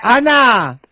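bright, upbeat DJ reveal; cheerful radio host. Real, not acted. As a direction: announce the prank with glee.

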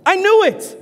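high, triumphant sneer. Acted. Defiant boy sneers at principal, high and smug. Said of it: "sneered the boy".

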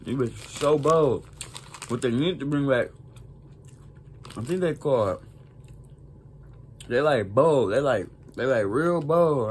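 chewing while talking; talking between bites; mouth full of chips. Real, not acted. Seen 0: Lips pressed, jaw working between phrases; chip in hand throughout.

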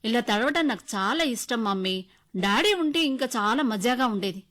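put-on loud, gleeful little girl; excited child. A narrator's reading. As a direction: shout it with a child's delight. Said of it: "అంది". (ఉత్సాహంగా గట్టిగా అరిచే పాప)

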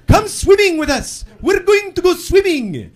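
put-on high, slow boyish invitation; young Italian boys. Italian boys on scooters invite me swimming, high and slow. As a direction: lure him along sweetly. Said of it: "and they go".

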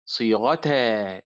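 صيغتا stretched slowly; slow drawn-out word. Shown as a demonstration. Teacher slowly stressing صيغتا for students. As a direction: draw out صيغتا for emphasis. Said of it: "آه".

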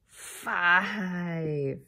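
gasp, then a hushed "Five"; amazed gasp. Real, not acted. Teacher gasps in surprise at five syllables. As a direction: gasp at the big number.

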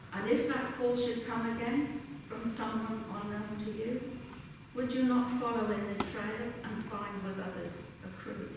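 ordinary talk, nothing marked

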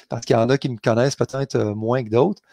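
ordinary delivery

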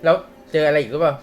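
normal talking voice

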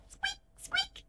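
tiny high-pitched mouse squeaks; quiet mouse squeak. Acted. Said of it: "but it's very quiet".

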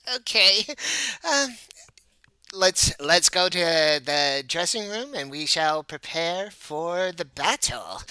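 stammer, then announce it with flourish; flustered show manager. Acted. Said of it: "he turns red, like, when you pinch his cheek".

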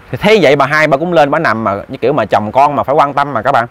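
usual speaking voice

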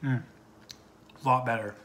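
speaking between bites; chewing while talking. Real, not acted. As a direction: mouth partly full. Seen 0: Bites potsticker at -4, cheeks working through "Mm, a lot better.

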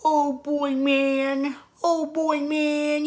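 high, even-pitched cartoon slacker voice; Shaggy. YouTuber showing a second kept impression, high cartoon voice. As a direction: hold it high and drawn along one note. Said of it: "And then there's Shaggy".

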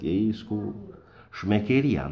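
ordinary talk

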